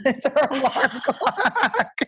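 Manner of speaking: slow words through laughter; laughing. Real, not acted. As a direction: giggle out the punchline.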